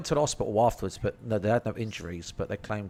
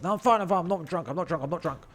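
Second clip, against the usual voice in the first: put-on insisting drunkenly; 7 semitones higher than usual. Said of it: "I was like".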